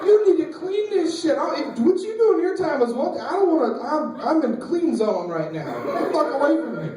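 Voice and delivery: high-pitched exasperated outburst; indignant scolding. Acted. Marine to buddy while cleaning, indignant and exasperated.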